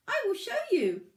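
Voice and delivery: a tiny stone, a bit flustered; small pebble voice. A narrator's reading. Pebble character speaks.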